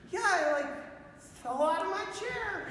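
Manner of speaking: high-pitched, guileless imitation; sweet man. Sweet man explaining his fall to a helper, guileless. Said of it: "He's, like, the sweetest man".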